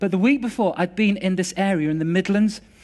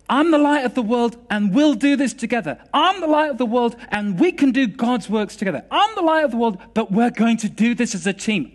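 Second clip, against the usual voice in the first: higher, emphatic proclamation; raised, rousing preacher's delivery; preaching declaration; 5 semitones higher, 4 dB louder than usual. Retold or imitated. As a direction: proclaim it to the whole room, punching each opening. Voicing Jesus's declaration with force.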